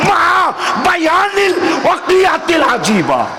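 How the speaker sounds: very high, sustained sermon cry; ringing, impassioned preacher's chant; full-force preaching. Real, not acted. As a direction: proclaim it in a soaring shout to rouse the crowd.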